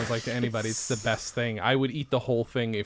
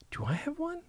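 worried; 13 semitones higher, 10 dB quieter than usual. Retold or imitated. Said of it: "I have several times been worried".

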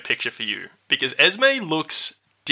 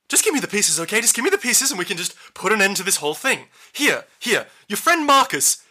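urgent demand; 7 semitones higher than usual. Acted. Villain demands pieces from the party.

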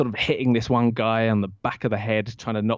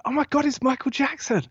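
high, excited squeal of a fan; excited fan scream. Retold or imitated. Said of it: "people are screaming".

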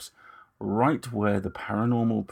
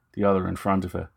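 ordinary delivery, nothing marked

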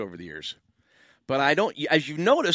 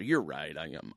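ordinary talk

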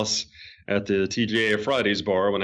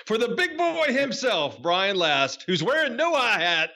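lightly high joking sign-off voice; mock big boy; 9 semitones higher than usual. Host signing off the show teasing his co-host, lightly high mock voice. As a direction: tease the co-host with the catchphrase.

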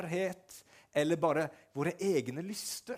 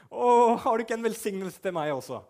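higher, louder pleading cry; 7 semitones higher, 8 dB louder than usual. Retold or imitated. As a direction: beg your father for a blessing, anguished. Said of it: "Han roper".

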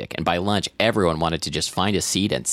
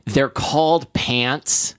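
put-on 1 snippy older sister; 6 semitones higher, much slower than usual. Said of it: "his older sister said".

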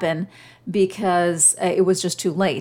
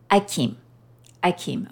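put-on baby saying ice cream. Mother imitating her baby son's first words, lightly toddler-voiced. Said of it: "he would say it like this".

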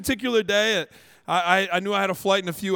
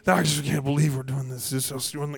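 low, soft grumbling; fuming under breath; 6 semitones lower, 3 dB quieter than usual. Retold or imitated. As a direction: imitate a driver stewing to himself. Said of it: "he's kind of fuming".

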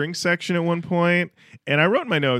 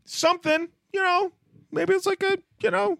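high voice. Pretend. High-pitched musing.

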